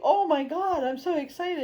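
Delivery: high, loud, hammed-up gush; mock excitement. Reader mocks the client's giddy excitement, hammed up high and loud. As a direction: squeal it like a giddy fan.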